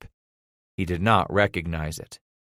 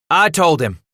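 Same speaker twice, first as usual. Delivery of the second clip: put-on louder, higher boy's voice, no stammer; speaking up; 8 semitones higher, 7 dB louder than usual. A narrator's reading. Son repeating confession to father, speaking up. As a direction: force the confession out in one go.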